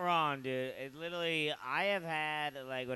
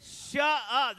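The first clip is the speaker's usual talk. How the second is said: retold shout; 7 semitones higher, 5 dB louder than usual. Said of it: "That's what I said".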